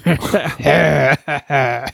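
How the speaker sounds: cartoon ogre cackle; ogre laugh. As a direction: laugh like a monster from a swamp. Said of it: "You like my ogre laugh?".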